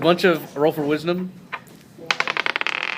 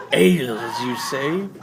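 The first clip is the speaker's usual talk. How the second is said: intrigued hobgoblin, louder; 4 dB louder, slower than usual. Acted. Hobgoblin repeats, rising up.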